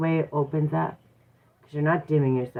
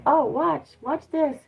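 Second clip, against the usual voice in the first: much higher, playful teasing voice; high and playful, as if saying just watch this; God speaking; 11 semitones higher, slower than usual. Retold or imitated. God teasing the speaker to watch, high and playful. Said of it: "that's when God said".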